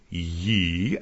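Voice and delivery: ordinary talk, nothing marked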